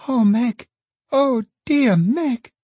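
put-on a little girl crying out through tears; sobbing child. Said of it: "in a burst of sobbing".

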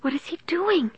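thin, high frightened question; terrified. A narrator's reading. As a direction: ask in a high, scared little voice. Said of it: "tight with terror".

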